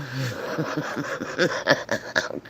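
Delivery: long real laugh, trailing away. Real, not acted. Owner amused by her stubborn bird, laughing. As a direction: crack up at your own story. Cracks up at her own story.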